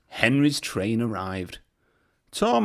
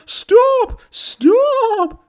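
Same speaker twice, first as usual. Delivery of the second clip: very high, loud panicked shriek; piercing loud shriek; 9 dB louder than usual. A narrator's reading. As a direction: scream for him to stop. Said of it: "shrieked Annie and Clarabel".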